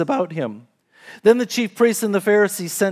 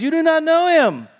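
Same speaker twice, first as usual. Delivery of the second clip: much higher, insistent declaration; emphatic confrontation; 9 semitones higher, 3 dB louder than usual. A narrator's reading. Preacher voices Jesus challenging the crowd, emphatic and high. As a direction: pitch it high to challenge the listeners.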